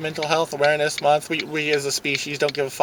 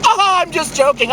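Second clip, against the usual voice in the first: mocking the joker; 12 semitones higher than usual. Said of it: "and going".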